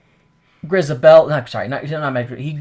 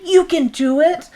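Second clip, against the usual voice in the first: urgent encouragement; 14 semitones higher, 5 dB louder, slower than usual. Retold or imitated. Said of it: "she looks at Mistopheles".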